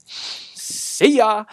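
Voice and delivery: high, over-eager farewell; too enthusiastic. Real, not acted. Waving off a departed wrestler, too enthusiastic. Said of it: "that sounded a bit too enthusiastic".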